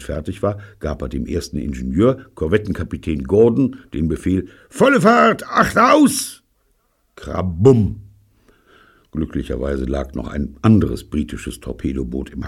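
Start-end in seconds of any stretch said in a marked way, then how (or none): 4.7-6.4 high, loud ship's order; shouted command. Acted. 7.1-8.0 crash sound. Acted. Explosion noise.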